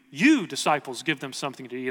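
single them out with a strong "You"; stress on YOU. Acted. Jesus putting it back on the disciples, stress on "You". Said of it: "the emphasis is on the word you".

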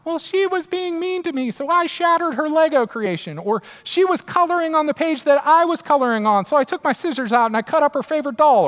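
put-on high-pitched child making excuses; imitating a kid. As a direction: whine like a kid shifting blame. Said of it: "I hear this from my kids".

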